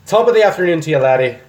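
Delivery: hammed-up Irish greeting to a laddie; playful fake Irish accent. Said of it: "That's Irish Scottish".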